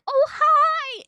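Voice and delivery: high, loud mock-sweet greeting; imitating a gushing hello; cheery greeting. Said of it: "everyone's like".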